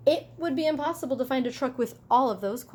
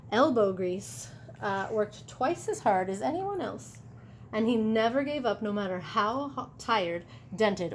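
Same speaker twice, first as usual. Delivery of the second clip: ordinary delivery, nothing marked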